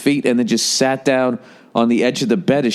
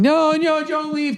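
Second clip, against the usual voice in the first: very high mock pleading; pleading girlfriend; slower than usual. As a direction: beg him to stay, pitched way up. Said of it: "she's fucking".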